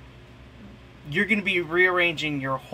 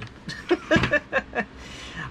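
breathy trailing chuckle; 9 semitones higher, 3 dB quieter than usual. Real, not acted. Vlogger teasing about gear nobody needs, chuckling. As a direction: chuckle at your own jab. Amused at needless expensive gear.

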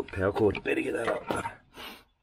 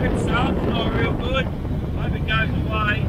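inside a helmet; 6 semitones higher, 13 dB louder than usual. Real, not acted. Talking while riding with helmet on.

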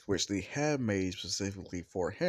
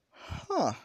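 much higher voice, a little puzzled Iida; Iida voiced; 8 semitones higher, slower than usual. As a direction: rethink it aloud, pitched high. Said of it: "which Ia's like".

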